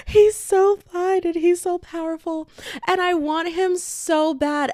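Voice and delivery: high-pitched swooning whine; lovestruck gushing. Real, not acted. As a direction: pine for him, high and pleading. Gushing over fictional crush.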